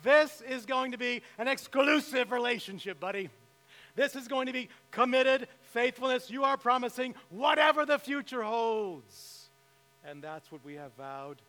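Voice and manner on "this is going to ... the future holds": a hint of a stern father, louder and higher; crystal clear. Father-in-law warning the suitor, mildly voiced firm insistence. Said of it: "he was crystal clear with me".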